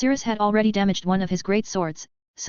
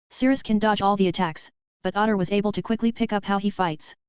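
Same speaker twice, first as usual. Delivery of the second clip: usual speaking voice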